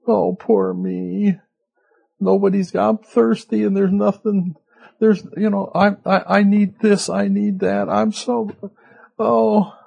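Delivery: whiny mock complaining. Preacher mocks murmuring Israelites with a high whine. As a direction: mimic a grumbling Israelite's whine.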